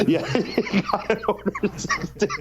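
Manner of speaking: high squealing laugh through the words; laughing hard. Real, not acted. Answering his co-host's joke, laughing high. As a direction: giggle the line out, barely holding it.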